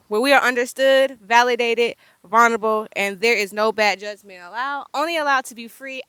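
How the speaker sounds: loud announcer-style show intro; podcast intro. Acted. Host welcoming listeners back to the podcast, announcer style. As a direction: announce the show with full energy.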